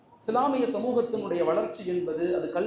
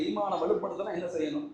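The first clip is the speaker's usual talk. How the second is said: ordinary talk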